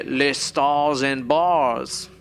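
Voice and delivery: slow, drawn-out bad French accent; mock French accent. Joking about rebel flags in France, mock French accent. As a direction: drawl it in hammy fake French. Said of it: "Our accents are way all over the place".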